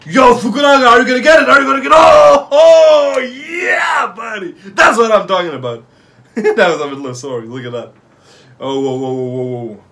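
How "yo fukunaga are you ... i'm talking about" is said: loud, high hype yelling; excited shouting. Real, not acted. Cheering during a rally.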